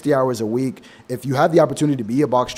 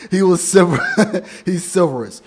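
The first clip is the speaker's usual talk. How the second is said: laughing through the pun; chuckling at own joke; 4 semitones higher than usual. Real, not acted. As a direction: crack yourself up on "silverous".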